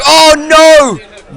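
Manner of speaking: shocked high shout; surprised. Acted. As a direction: gasp it out as the ace lands. Sudden team fight turn.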